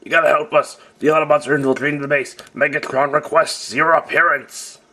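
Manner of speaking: high, urgent toy skit voice; frantic soldier calling for help; panicked minion voice. Pretend.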